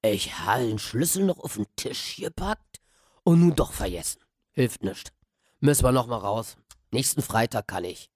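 a crusty old caretaker shrugging off bad luck; gruff old Berliner. Old man voiced in dialect.

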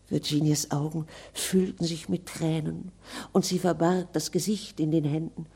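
ordinary delivery, nothing marked

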